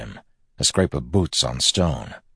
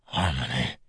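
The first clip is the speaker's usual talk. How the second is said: soft, hushed call to Harmony; low, softly whispered appeal; 3 semitones lower, 6 dB quieter than usual. A narrator's reading. Injured man whispers to his god, hushed. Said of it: "he whispered".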